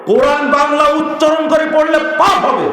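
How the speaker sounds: high, loud, forceful preaching; angry warning. Real, not acted. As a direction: cry out the warning. Warns sharply that it is sin. (চড়া গলায় কড়া সতর্কবাণী)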